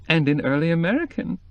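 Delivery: high delighted shriek; shrieking with laughter. A narrator's reading. Cokie laughing at the brothel furnished in early American, shrieking. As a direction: squeal it out amused. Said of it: "she shrieked".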